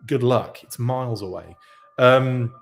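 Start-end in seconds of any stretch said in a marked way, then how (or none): none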